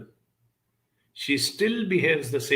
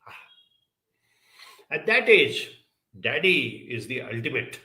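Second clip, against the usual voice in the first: normal talking voice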